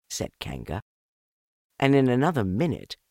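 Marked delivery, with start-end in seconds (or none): none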